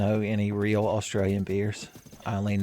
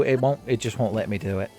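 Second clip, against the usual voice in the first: ordinary talk, nothing marked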